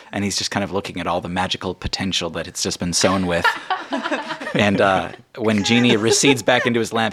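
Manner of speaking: ordinary delivery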